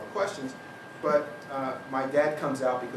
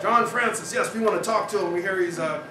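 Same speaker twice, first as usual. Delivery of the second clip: loud, laughing official voice; eager officials; 6 semitones higher, 7 dB louder than usual. Retold or imitated. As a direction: rush the request through laughter. Officials on the phone.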